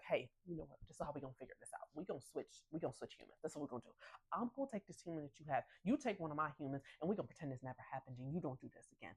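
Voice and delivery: soft, fast conspiratorial plan; hushed scheming. Retold or imitated. Ralph plotting the human swap, hushed and fast. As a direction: whisper the cover-up plan quickly.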